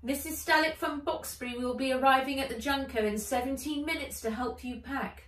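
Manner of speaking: high, bright little creature voice; high dragon companion voice. Acted. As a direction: chirp it like a small dragon. Dragon announces arrival.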